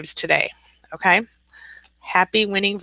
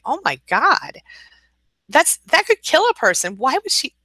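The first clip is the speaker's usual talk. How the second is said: appalled; 10 semitones higher than usual. Real, not acted. Reacting to hammer attack story.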